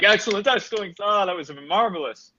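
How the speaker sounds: high, gushing character voice; delighted host. Acted. Calvin clapping for the winner, high delighted exclamation. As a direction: applaud in a high, swooping voice.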